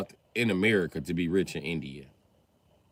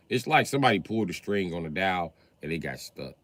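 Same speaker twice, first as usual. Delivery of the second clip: normal talking voice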